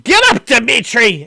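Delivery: loud, high shout to wake him; angry shout. Acted. Anna yells at sleeping husband Dimitri, angry and loud.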